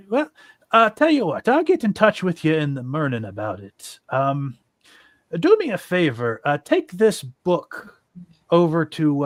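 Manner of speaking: chatty Irish-ish character voice; almost Irish accent. Acted. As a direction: put on a weird, not-quite-Irish accent. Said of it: "a weird, almost Irish accent for him".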